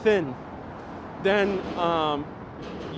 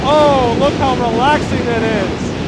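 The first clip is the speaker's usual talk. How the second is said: loud, drawn-out exclamation over noise; raised voice; 6 semitones higher, 10 dB louder, slower than usual. Real, not acted. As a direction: call it out slowly above the roar. Talking over loud train noise.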